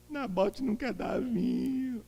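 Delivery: high, soft, whining voice; whiny Ahab. Retold or imitated. Ahab whining to his wife about Naboth, soft and whiny. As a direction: whimper it like a sulking king.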